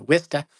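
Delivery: Wista said high and clipped, WIS-ta; correct pronunciation. Shown as a demonstration. Host on a card stream says the proper way, Wista. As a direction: stress the first syllable of Wista. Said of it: "correct pronunciation".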